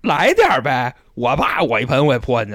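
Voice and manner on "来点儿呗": challenging. Retold or imitated. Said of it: "我说：".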